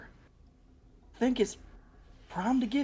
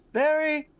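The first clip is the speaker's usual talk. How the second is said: laughing; 6 semitones higher, 3 dB louder than usual. Real, not acted. Laughing at drunk character.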